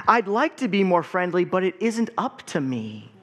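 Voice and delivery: put-on lively picture-book read-aloud for kids; story time reading. A narrator's reading. Pastor reading a picture book to the congregation, lively read-aloud.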